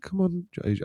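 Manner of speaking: high, soft English mum voice; put-on English accent, pitched high. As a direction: coax a child in an English accent. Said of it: "kind of an English accent".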